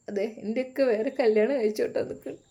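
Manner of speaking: plain reading voice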